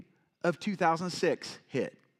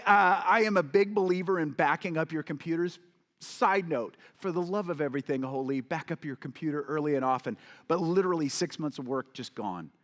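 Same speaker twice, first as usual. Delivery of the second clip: normal talking voice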